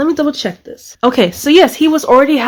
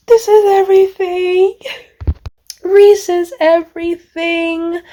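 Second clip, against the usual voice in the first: emotional gushing; 8 semitones higher than usual. Real, not acted. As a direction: swoon over it, pitched way up. Overwhelmed fan gushing over the book.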